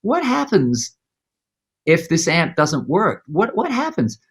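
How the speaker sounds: fretting over the broken amp; anxious inner voice. Retold or imitated. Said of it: "I was thinking".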